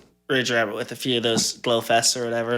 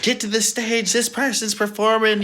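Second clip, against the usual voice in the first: high-pitched call-out at normal volume; raised-pitch mock announcement yell; mock host's yell; 8 semitones higher than usual. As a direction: imitate hollering at people from the stage. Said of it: "yell on the mic".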